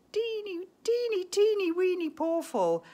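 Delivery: tiny-voice emphasis; cutesy high. Real, not acted. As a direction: squeak it out cute. Cutesy emphasis on small amount.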